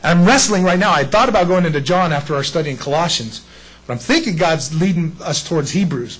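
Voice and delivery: ordinary talk, nothing marked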